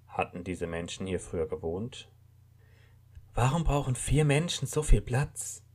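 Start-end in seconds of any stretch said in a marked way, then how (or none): 3.3-5.6 a lightly voiced bewildered young man, higher; muttering lost and disoriented; confused man. A narrator's reading. Waking man asks himself where he is, bewildered.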